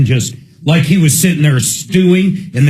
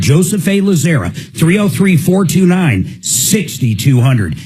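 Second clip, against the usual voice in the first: ad read. Acted. Repeats number in ad.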